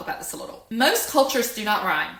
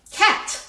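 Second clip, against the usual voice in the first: CAT in one short, high, loud beat; one syllable; 14 semitones higher, 6 dB louder than usual. Shown as a demonstration. Teacher showing one syllable, one-beat CAT. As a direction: punch "cat" as a single syllable. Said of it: "That's one syllable".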